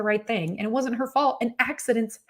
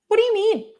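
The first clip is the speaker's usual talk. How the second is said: incredulous challenge; 13 semitones higher than usual. Real, not acted. Challenging her own self-pity.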